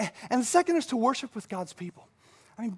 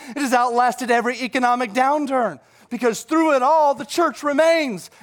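fervent preaching; 4 semitones higher, 7 dB louder than usual. Real, not acted. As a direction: build louder and higher to a climax. Preaches at full force.

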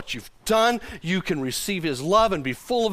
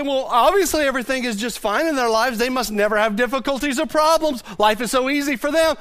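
mock envious remark; 6 semitones higher, 4 dB louder than usual. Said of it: "you'd look in their face and say".

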